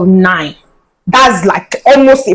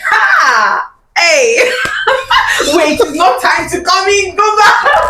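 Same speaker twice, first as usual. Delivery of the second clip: laughing shout; 8 semitones higher, slower than usual. Real, not acted. Host laughing, shooing guest out.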